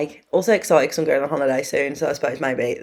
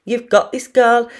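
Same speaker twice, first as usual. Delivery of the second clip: cheery mum; 6 semitones higher, 4 dB louder than usual. Retold or imitated. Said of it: "Mum was like".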